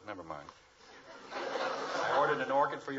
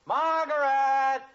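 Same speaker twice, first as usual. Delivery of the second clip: very loud, high, slow stretched-out call; long call; 14 semitones higher, 10 dB louder, much slower than usual. Acted. Father calling his wife a second time, long loud stretched call. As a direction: hold the name out long and loud.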